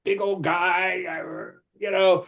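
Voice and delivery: ordinary delivery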